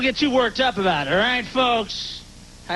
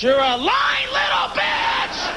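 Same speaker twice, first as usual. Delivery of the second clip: calm start snapping into a high, slow scream; cop's sudden scream; much slower than usual. Retold or imitated. Cop letting him go, then screaming, calm turning to raging scream. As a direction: let it go calm then explode. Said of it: "he goes".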